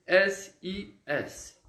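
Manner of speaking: S I S letter by letter, slowly; spelled-out sound. Shown as a demonstration. Teacher showing pupils how six sounds, slowly spelled out. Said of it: "a gente vai pronunciar assim".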